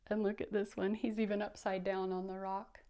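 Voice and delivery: plain reading voice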